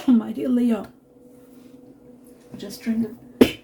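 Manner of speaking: coughing, struggling to get words out; choking. Real, not acted. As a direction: talk through a choking cough. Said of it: "Sorry, I was choking there".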